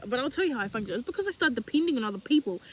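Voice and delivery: ordinary talk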